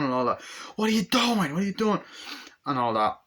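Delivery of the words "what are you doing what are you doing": mock alarmed shout. Acted partner in chase.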